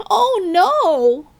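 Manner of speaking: high, loud oh no; storyteller groaning at the spill; dramatic dismay. A narrator's reading. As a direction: gasp it out as disaster strikes. Narrator dramatizes accident.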